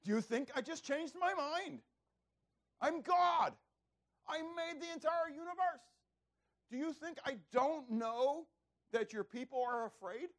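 high, indignant outburst as God; exasperated, high-pitched divine rebuke; stronger scolding. Acted. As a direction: thunder at someone who doubts your word. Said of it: "Maybe a little bit stronger".

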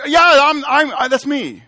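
high, loud eager self-identification; emphatic exclamation. Shown as a demonstration. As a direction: pipe up loudly to claim it's you. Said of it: "an emphatic expression at times".

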